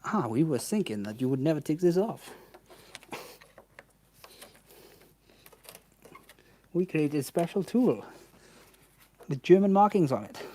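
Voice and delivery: Mercedes engineers in a hammed-up German accent; mock German accent. Mechanic voicing Mercedes engineers, mock German accent. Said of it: "I'm sorry for my German accent".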